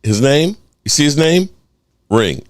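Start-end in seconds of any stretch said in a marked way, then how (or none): none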